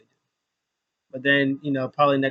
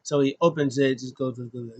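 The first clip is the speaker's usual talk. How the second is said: ordinary talk, nothing marked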